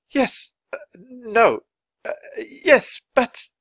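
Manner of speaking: high, loud, halting indecision; flustered. A narrator's reading. Writer answering the giant ghost, flustered. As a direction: stammer between answers, pitch jumping up.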